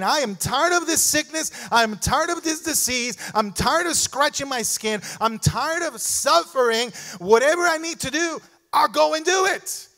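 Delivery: rising to a high, loud cry of resolve; fervent resolve. Acted. Preacher voicing a desperate leper, crying out he'll move. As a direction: preach it like a desperate sick man ready to go.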